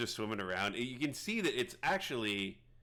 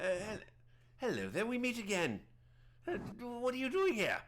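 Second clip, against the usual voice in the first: very high, swooping hermit voice; surprised druid meeting the party, pitched way up; Prattis; 11 semitones higher, 9 dB louder than usual. Acted. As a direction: put on a high eccentric hermit voice. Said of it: "I don't remember his accent".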